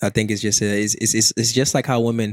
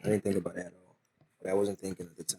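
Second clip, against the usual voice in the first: mumbling quietly; 3 semitones lower, 12 dB quieter, faster than usual. Real, not acted. Trailing off, mumbled.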